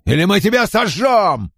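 high, strained shout of menace; threatening yell. A narrator's reading. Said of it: "заорал он". (угрожающий крик)

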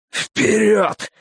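high, strained order, short of air; breathless command. A narrator's reading. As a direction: command as if there's no air left. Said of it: "голос его звучал так, будто ему не хватает воздуха". (команда, задыхаясь)

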